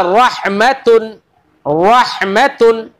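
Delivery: रहमतुन with a full, heavy ra, drawn out slowly. Shown as a demonstration. Teacher showing students the heavy ra, slow and full. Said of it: "रहमतुन में रा पुर है ना".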